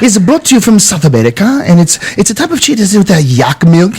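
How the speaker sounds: imitated waiter reciting a dish description; interrupting waiter. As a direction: put on a waiter presenting the cheese plate. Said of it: "this motherfucker comes over with a plate".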